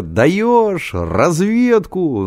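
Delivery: high, drawn-out rallying cry; excited cry. A narrator's reading. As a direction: stretch it out like a jubilant slogan. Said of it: "закричал Генка".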